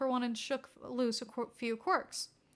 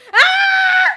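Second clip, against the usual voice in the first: loud high scream; boy terrified by the villain girl; startled scream; 19 dB louder than usual. Acted. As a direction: shriek in fright. Izuku screams in fright.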